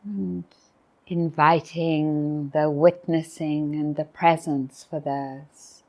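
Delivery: guided meditation. Real, not acted. Teacher inviting retreatants to be present at the closing morning, guided meditation. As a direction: invite them into stillness, with long pauses. Leads a reflective pause.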